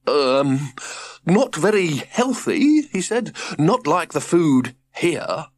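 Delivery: a fussy health-nut father; prim father voice. Acted.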